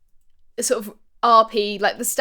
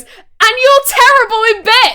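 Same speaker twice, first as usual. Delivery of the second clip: much higher, louder hammed-up yell; mock shouted insult; 12 semitones higher, 12 dB louder than usual. Blooper retold, actor shouting into the phone, hammed-up yell. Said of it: "picks it up and goes".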